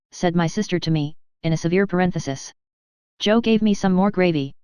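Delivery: usual speaking voice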